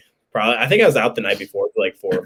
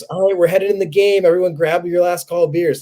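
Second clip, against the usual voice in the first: higher-pitched mock parent announcement; parent calling out; 7 semitones higher than usual. Parent tells the tailgate to head in, mock calling out. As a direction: lightly imitate a parent rallying the group.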